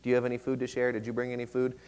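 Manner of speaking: lightly acted near-whisper, halting; hushed whispering. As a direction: ask around discreetly under your breath. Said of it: "like, whispering to themselves".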